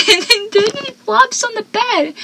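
high, excited squeal; hammed-up excitement. Real, not acted. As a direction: gush it breathlessly. Dramatic retelling, voice jumps.

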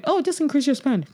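high-pitched mock rep's breezy advice; imitated Google rep. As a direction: toss it out high, as a glib rep would. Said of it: "instead of just going".

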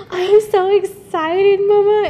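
high, loud, slow and gushing; mother gushing to her pregnant daughter, high and loud; excited mom. Retold or imitated.

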